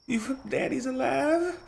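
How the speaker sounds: put-on high, small child's voice, amazed. Small boy asking his parent about his father. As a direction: gasp it out like a little kid.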